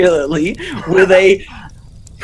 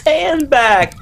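ordinary delivery, nothing marked

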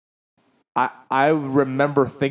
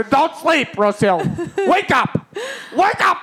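high, urgent mock yell to wake up; doctor yelling a patient awake, retold; imitated yelling. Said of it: "Doctor Dell's yelling beside her".

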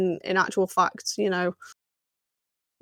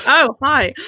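a higher, louder breezy oh hi; mock casual hi; 5 semitones higher, 6 dB louder than usual. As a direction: toss off a careless hi, imitating her. Echoing the mock greeting.

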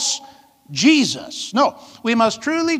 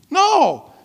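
ordinary delivery

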